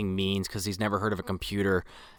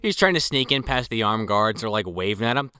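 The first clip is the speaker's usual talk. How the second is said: normal talking voice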